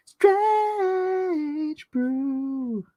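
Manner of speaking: very high, stretched-out title call; playful high-pitched long cry; high drawn-out holler. Pretend. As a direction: stretch both words in a high wail.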